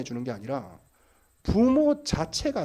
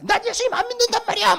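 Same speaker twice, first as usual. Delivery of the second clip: put-on whiny defiant teen; 13 semitones higher than usual. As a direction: play the stubborn kid yelling back at mom. Teen retorts to mother.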